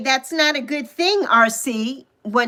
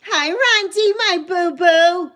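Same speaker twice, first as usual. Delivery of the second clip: higher, slow and gushing; excited greeting; 6 semitones higher, slower than usual. Real, not acted. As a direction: gush over your best friend. Greeting best friend on stream.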